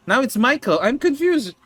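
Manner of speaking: high, loud baffled outcry; loud bewildered protest; confused. Real, not acted. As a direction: squeal the confusion. Confused outburst at game names.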